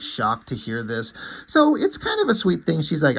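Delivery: normal talking voice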